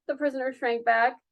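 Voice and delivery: ordinary delivery, nothing marked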